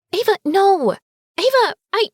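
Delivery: high, loud protest; shouting. A narrator's reading. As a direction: call out to stop her. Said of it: "Harper shouts".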